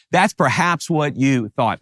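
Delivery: usual speaking voice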